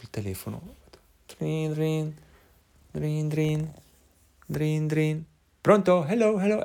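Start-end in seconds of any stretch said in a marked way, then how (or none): 1.4-5.2 high, even drin drin like a ringing phone; phone ring imitation. Calling the babysitter's number, mimicking the ring tone. 5.6-6.6 high, loud pronto and hello; eager phone greeting. Retold or imitated. Girl answering his call, loud high greeting.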